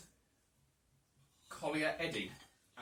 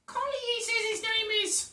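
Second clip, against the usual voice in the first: high Cockney woman voice; chatty Cockney lass; Cockney lady accent; 4 dB louder than usual. Acted. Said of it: "brilliant Cockney lady accent".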